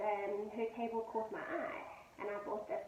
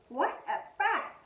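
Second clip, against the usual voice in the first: mock scream; 9 semitones higher, 8 dB louder, slower than usual. A narrator's reading. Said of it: "I heard Mum scream".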